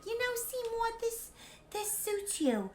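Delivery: lightly voiced sweet girlfriend; sweet Audrey voice. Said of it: "Audrey one is like".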